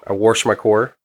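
"wash" as "warsh" and car as "core"; thick Midwestern vowels on "wash" and "core". Retold or imitated. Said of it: "Midwesterners say warsh".

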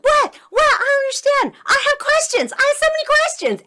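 put-on very high, loud kid's protest; shouted protest. Child protests being dragged from class, shouted protest. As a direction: yell it like a child being hauled away.

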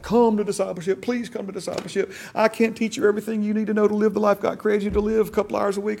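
pleading. Retold or imitated. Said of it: "To like".

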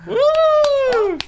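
loud high woo; cheering. Real, not acted. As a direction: whoop for the guest. Cheering the guest welcome.

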